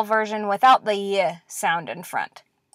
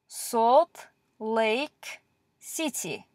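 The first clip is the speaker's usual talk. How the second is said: slow sounding out; 3 dB quieter, much slower than usual. Shown as a demonstration. Teacher sounding out a word slowly.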